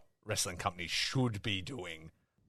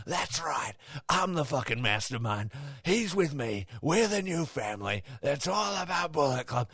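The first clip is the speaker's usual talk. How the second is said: higher-pitched mock villain boast; put-on, high boastful voice; imitated gloating wrestler; 5 semitones higher than usual. Acting out wrestler's gloating speech.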